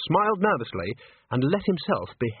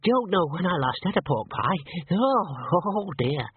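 squeaky, higher-pitched man; 6 semitones higher than usual. A narrator's reading. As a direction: squeak it out, slowing on the last words. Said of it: "said the man in a squeaky voice".

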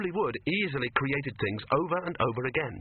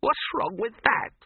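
high, slow, dazed protest; dazed and wounded; muzzy indignant; 11 semitones higher, 4 dB louder, slower than usual. A narrator's reading. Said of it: "he said muzzily".

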